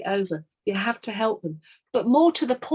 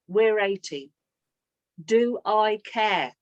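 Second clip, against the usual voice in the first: normal talking voice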